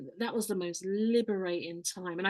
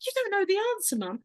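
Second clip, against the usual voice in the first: put-on high, shocked little girl voice; horrified child; 11 semitones higher than usual. Daughter gasping at mum's ignorance, high and shocked. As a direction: play the child stunned that mum doesn't know. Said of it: "the look of horror".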